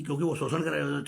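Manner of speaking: normal talking voice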